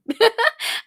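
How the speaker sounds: a bright high burst of laughter. Real, not acted. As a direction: laugh at your own luck.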